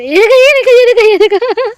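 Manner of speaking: high, loud, thrilled squealing; excited squeal. Real, not acted. Girl shows viewers her cat, squealing with delight.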